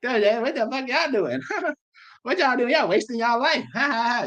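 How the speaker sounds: high, jeering put-on voice; mock laughing. Preacher acts out people laughing at men of the Lord, high mocking laughter. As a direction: imitate scoffers, high-pitched with fake laughs. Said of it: "You over there laughing at them".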